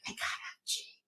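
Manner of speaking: hushed whisper. Retold or imitated. Crowd whispering about the Jeep. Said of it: "hear the whispers".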